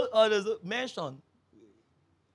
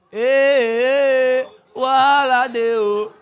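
loud, drawn-out mock wail; comic lament; 3 semitones higher, 8 dB louder, much slower than usual. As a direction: wail it slowly as trouble looms. Laments the trouble to come.

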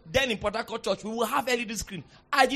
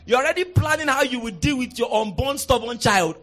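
usual speaking voice

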